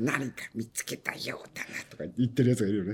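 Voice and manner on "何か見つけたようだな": whispered impression of someone; very soft, voiceless mimicry; character impression. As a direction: murmur it in a hushed, whispered put-on voice. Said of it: "喋り方から仕草までっていうのがいる".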